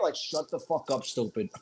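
usual speaking voice

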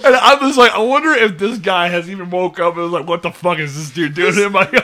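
laughing imagined quote. Retold or imitated. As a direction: crack up imagining it. Laughing, imagining owner's reaction.

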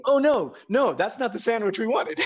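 high, laughing mock panic; laughing protest. Retelling the sandwich mix-up to his friend, laughing protest. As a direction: backpedal through laughter. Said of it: "And I was like".

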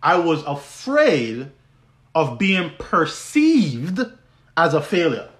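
AFRAID and PERCEIVED drawn out slowly; deliberate restatement, stretching AFRAID and PERCEIVED; emphatic repeat. Real, not acted. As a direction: lean long and slow on "afraid" and "perceived", pausing after each. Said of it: "Listen to what I said again".

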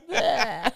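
put-on loud high retching bleh of a child; imitated disgusted bleh. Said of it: "He was like".

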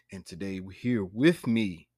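ordinary talk

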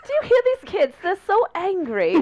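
high, hammed-up British accent; mock dismay in a silly British voice. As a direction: tease the kids in exaggerated British. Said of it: "in a British accent".

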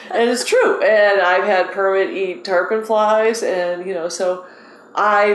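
usual speaking voice

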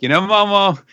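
high, teasing cartoon voice; mocking paraphrase. Podcaster paraphrasing Luffy teasing Momo, high mock voice. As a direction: rib the kid in a squeaky put-on voice. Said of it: "doing the thing where".

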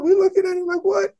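a very high, squeaked "What?"; the whole team caught off guard, pitch flying up; stunned disbelief. Retold or imitated. Players reacting to their cursing coach, high stunned squeak.

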